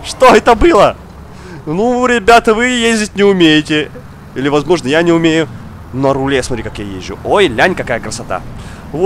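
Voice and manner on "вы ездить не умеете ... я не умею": ordinary talk, nothing marked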